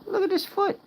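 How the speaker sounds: very high, tiny pet voice; squeaky piggy voice, a bit fast at the end; squeaky guinea pig voice. Acted. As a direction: speak for the pig in a teeny squeak.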